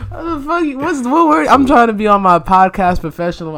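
high, loud, cracking up in disbelief; laughing protest. Real, not acted. Guest teasing host over a dictionary word, laughing protest. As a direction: protest loudly through your laughter.

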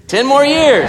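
loud, high, thrilled; excited cheer. Acted. Preacher whooping after the husband's sweet speech, excited. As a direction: shout it out with glee.

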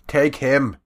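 loud, high barked command; shouted order. Acted. Bluecoat leader shouts for his men to attack, loud order. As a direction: yell it to launch the attack.

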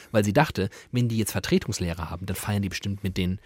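normal talking voice

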